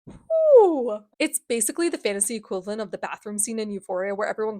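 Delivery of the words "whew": loud high exhale, sliding down; high whew. Real, not acted. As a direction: let out a big "whew" after a rant. Reacts after voicing long rant.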